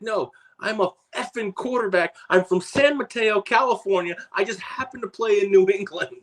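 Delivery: very high-pitched imitation of protesting; put-on high voice, correcting someone; mock indignant protest. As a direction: imitate an exasperated man setting the record straight. Retold quarterback protesting the mistaken label.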